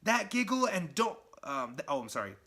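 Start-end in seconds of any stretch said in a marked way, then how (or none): 0.0-1.2 higher, louder incredulous voice; can't believe what he's seeing; startled hero. Retold or imitated. Spider-Man spotting the giggling bombs, high startled disbelief. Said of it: "He's like".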